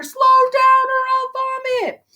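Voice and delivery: very high, loud panicked shout; panicked holler. Acted. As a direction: yell over the rushing sleigh. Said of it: "causing Murray to holler".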